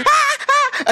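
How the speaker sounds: very high shrieks of alarm; hammed-up bystander screams; mock screaming. Onlooker joining a commotion, very high mock screams. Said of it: "sumisigaw siya".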